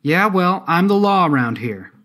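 high, loud show of authority; loud bravado. A narrator's reading. Artemis tells the silent men she is the law, loud bravado. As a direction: call it out to assert authority.

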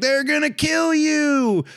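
high, drawn-out imitated warning call; mock yell. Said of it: "he's yelling at him".

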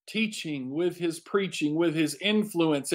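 ordinary delivery

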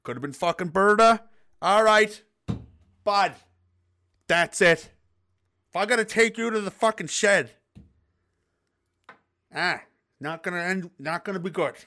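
half-Irish Newfoundland dad giving warnings; bad Newfoundland accent. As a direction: threaten in a put-on Newfoundland accent. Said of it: "half Irish Newfoundland accent".

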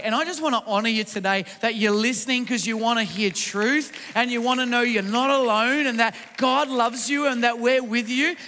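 ordinary delivery

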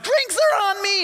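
high, loud call to the whole bar; party shout. Acted. Son buying rounds for his friends, exuberant shout. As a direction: whoop it out like a big spender.

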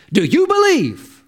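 high-pitched, insistent question; pointed question. Acted. Preacher voices Jesus' pointed question.

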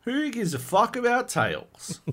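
high-pitched, drawn-out exasperated outburst; slow it down and pitch it right up, scornful; exasperated dismissal. Real, not acted. Host waves off the recasting question, exasperated.

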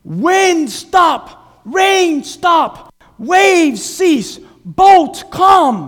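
high, loud commanding shouts; commanding rebuke. Acted. Jesus rebuking the wind and waves, loud commands. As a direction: order the storm to stop.

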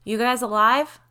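calling out. Acted. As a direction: call down the tunnel. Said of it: "when Biddy's voice rang out".

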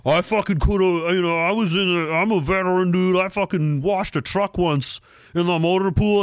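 lightly voiced boastful dumb veteran; mock veteran brag. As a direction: puff up like a proud veteran.

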